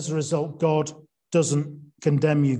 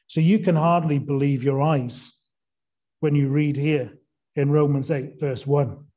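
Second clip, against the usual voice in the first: ordinary delivery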